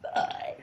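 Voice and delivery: burped word; talking while burping. Shown as a demonstration. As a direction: burp the word bye. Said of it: "I said bye".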